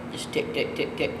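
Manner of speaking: soft breathy tick sounds into the call; whispered feed call ticks. Shown as a demonstration. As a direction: whisper each tick short and crisp. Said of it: "you can just say tick".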